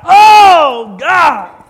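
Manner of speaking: loud, high hammed-up wail; mock agonized cry. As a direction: cry out as if just shot. Acts out being shot.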